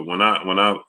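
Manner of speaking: ordinary talk, nothing marked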